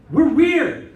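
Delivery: high, loud declaration; emphatic exclaim. Real, not acted. Preacher declaring to the congregation, high and loud. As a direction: proclaim it boldly.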